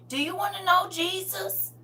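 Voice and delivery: put-on high, sweet little-kid voice; little child voice. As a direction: sound like a five-year-old knocking doors. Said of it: "I was like five".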